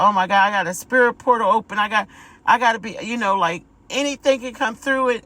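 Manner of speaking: mock panic. Retelling her past panic.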